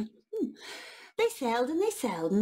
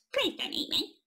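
a bit higher pleading voice; lightly voiced rabbit; 10 semitones higher than usual. Rabbit begging the troll, mildly higher. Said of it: "said the rabbit".

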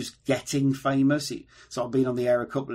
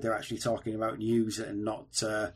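usual speaking voice

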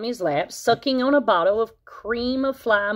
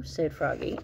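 ordinary delivery, nothing marked

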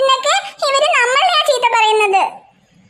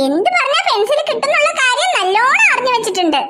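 ordinary talk

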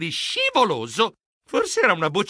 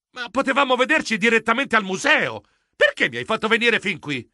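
higher-pitched, puzzled complaint; indignant protest; 6 semitones higher than usual. Acted. Geronimo questions his friend about meeting here, indignant. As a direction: object to the wasted trip.

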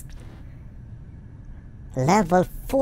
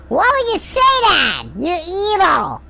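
mock indignant; 11 semitones higher, 4 dB louder than usual. Real, not acted. Reacting to villain's insult.

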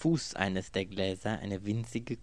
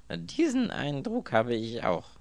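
verbittert; 5 semitones higher, 5 dB louder than usual. A narrator's reading. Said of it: "sagte Anthony verbittert".

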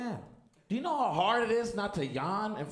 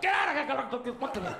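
raised, higher-pitched scolding; mock yelling; 6 semitones higher than usual. Award winner yelling at his kids while walking up, mock scolding. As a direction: yell like an exasperated parent. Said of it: "yelling at him, too".

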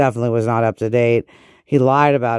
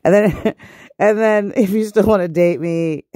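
higher, amused and giggly; laughing through words; 7 semitones higher than usual. Real, not acted. Host joking about dating despite the flaws, laughing. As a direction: talk through your own laughter.

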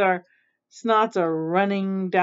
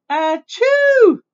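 high, loud fake sneeze; drawn-out mock achoo; acted sneeze; 11 semitones higher, 7 dB louder than usual. Aunt Nasty sneezing while refusing soup, mock sneeze.

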